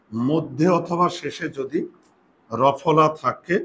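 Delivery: slow, clear dictating pace; slow dictation. Shown as a demonstration. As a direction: say it slowly so pupils can write it down. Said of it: "slow dictation".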